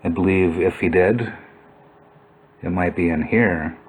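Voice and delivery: ordinary talk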